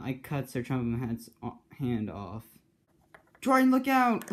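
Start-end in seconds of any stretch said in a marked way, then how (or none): 3.4-4.3 loud, high cry of warning; warning shout. Acted. James warning Dryden of the attack, loud high shout. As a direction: yell to save your friend.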